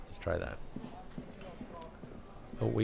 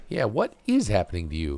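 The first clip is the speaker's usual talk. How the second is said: ordinary talk, nothing marked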